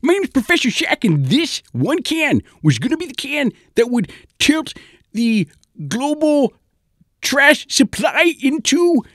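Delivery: very high, loud zany scientist rant; frantic inventor lecturing about trash; mad professor voice. Acted. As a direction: warn of doom in a squeaky cartoon professor voice. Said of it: "the man says".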